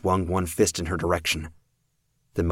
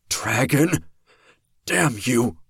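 loud pained curse; angry shout; 7 semitones higher, 5 dB louder, slower than usual. Acted. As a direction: curse her with his last strength. Dying man curses Dragon.

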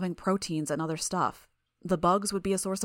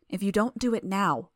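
plain reading voice

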